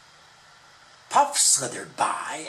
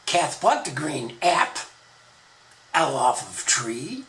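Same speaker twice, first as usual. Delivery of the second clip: usual speaking voice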